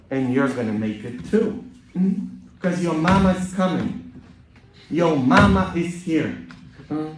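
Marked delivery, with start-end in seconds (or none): 2.6-6.4 hint of a US Southern accent, slightly raised pitch. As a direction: lightly put on a Southern preacher's accent. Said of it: "That's my southern accent for you".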